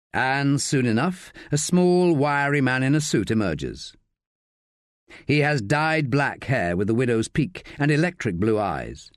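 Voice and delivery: plain reading voice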